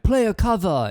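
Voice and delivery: high, loud heckle; imitated audience holler; mock heckler yell. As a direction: shout it at the band from the crowd. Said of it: "you just yell at them".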